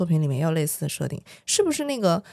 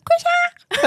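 very high, loud mock command; hammed-up high-pitched order; 5 dB louder than usual. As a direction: shriek it as an exaggerated order. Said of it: "他还是有一种命令性的口吻".

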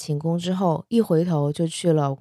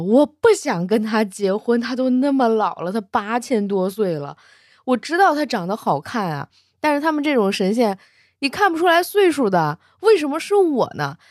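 put-on drunk and weepy; 5 semitones higher, 3 dB louder than usual. A narrator's reading. Young dragon drunk under the tree, whining tearfully about his marriage. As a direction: complain tearfully to the tree, a little tipsy. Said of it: "喝醉了， 哭兮兮的".

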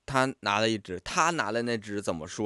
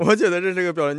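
normal talking voice

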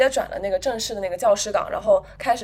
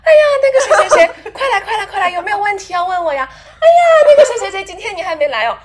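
mocking impression of a fawning colleague, higher and louder; 9 semitones higher, 10 dB louder than usual. Mocking a colleague calling students at the office door, sing-song and much higher and louder. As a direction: call out sweetly like you're luring customers. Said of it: "他就差站在那个".

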